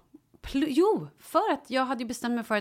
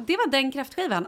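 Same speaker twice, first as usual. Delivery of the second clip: ordinary delivery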